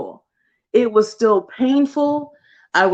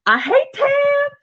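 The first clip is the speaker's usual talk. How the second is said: mock squeaky complaint; slower than usual. Acted venting.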